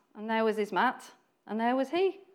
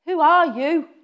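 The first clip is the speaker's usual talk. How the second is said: higher, louder demanding who are you; challenging shout; 6 semitones higher, 9 dB louder than usual. Retold or imitated. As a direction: hurl the question at him. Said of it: "How many ways can you say that".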